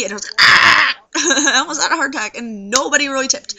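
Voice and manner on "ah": exasperated yell. Retold or imitated. Snow made it stressful.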